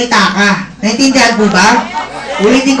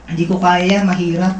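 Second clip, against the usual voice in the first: lower, softer doubtful voice; discouraged self-talk; 5 semitones lower, 5 dB quieter than usual. Retold or imitated. Speaker voicing a newcomer's self-doubt, low and soft. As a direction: sound defeated and unsure. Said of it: "nagsasabi sa sarili mo na".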